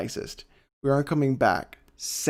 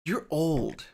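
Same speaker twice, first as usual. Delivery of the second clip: retold wife; 4 semitones higher than usual. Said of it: "And she's like".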